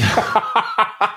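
high-pitched burst of laughter. Real, not acted. Laughing at own Drosten remark.

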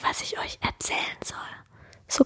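whisper, keep it low. Real, not acted. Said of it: "ich muss ein bisschen leiser sprechen".

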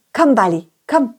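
plain reading voice

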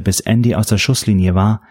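usual speaking voice

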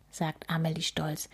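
plain reading voice

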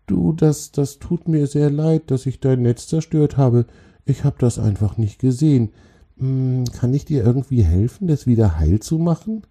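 high, sorry little troll voice; apologetic troll. Acted. Troll apologises to the spider and offers help, apologetic.

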